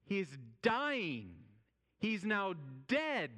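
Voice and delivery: high, slow, drawn-out cry; emphatic proclamation. Acted. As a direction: stretch it out and lift the key word high. Dramatic contrast with hushed awe.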